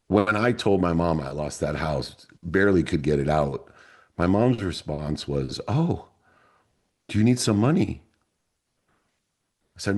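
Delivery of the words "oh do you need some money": higher, light mother's voice; gentle mom. Retold or imitated. Mom answering her son's news of ruin, gently.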